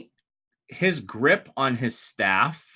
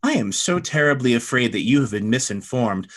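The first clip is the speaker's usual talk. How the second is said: higher-pitched, formal courtly introduction; outwardly composed, secretly mad woman; 4 semitones higher than usual. Acted. She introduces her friend to the Hope Master, outwardly composed. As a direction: play polite diplomacy over hidden irritation.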